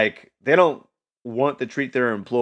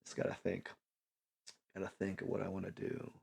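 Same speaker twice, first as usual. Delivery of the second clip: intense thought; 3 semitones lower, 20 dB quieter, faster than usual. Retold or imitated. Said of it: "really intense thought".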